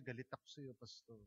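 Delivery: inner thought. Retold or imitated. The congregant's private thought about the pastor, hushed and low. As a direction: say the hidden resentment under your breath. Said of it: "sa isip-isip lang niya".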